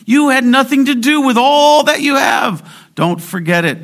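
loud, high, urgent pulpit cry; fiery sermon shout; preaching at full force. Acted. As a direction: thunder the warning at the congregation. Preacher's opening exhortation.

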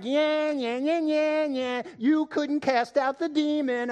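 high, jeering sing-out; mocking taunt. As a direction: gloat like a heckler rubbing in a failure. Mimics scribes gloating at failed apostles.

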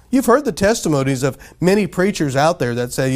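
normal talking voice

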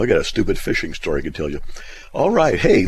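normal talking voice

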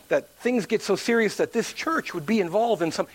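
ordinary talk

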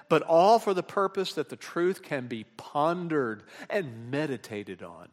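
ordinary talk, nothing marked

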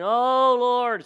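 high, loud showy cry to the Lord; loud mock prayer. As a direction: belt it out like a proud Pharisee. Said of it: "so loud".

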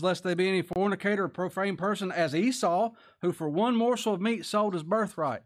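loud, high scripture proclaiming; fervent scripture reading. Real, not acted. Preacher to congregation, reading Hebrews loud and high. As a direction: proclaim it like a warning. Reads scripture at full force.

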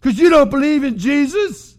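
loud, high mock shouting at the lost; mock woe. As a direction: ham up a street preacher's woe. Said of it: "and go".

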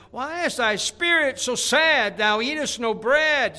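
high, loud, slow wifely fuss; Jezebel mock concern. Jezebel fussing over her sulking husband, hammed-up concern. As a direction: fuss over him like a doting wife. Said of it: "Jezebel's wife came unto him and said".

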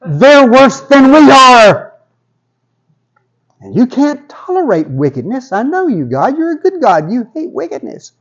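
very high, incredulous objection; Habakkuk protesting. Acted. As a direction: squeal a disbelieving protest up to God. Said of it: "Habakkuk responds".

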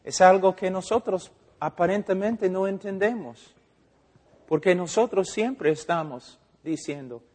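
ordinary delivery

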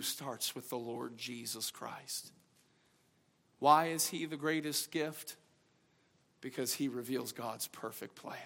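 soft, low, tender appeal; a quiet altar call; gentle invitation. Real, not acted. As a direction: draw them in quietly.

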